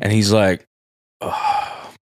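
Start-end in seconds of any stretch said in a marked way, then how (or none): 1.2-1.9 long breathy mock sigh; resigned sigh. Visitor weighing the husband's request, resigned sigh. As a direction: imitate a reluctant exhale. Retold visitor sighs, giving in.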